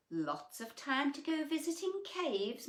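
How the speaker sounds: lightly voiced mumble through grass; a hint of a mumbling greedy zebra; mumbled. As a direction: mumble it with a full mouth. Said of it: "mumbled Greedy Zebra".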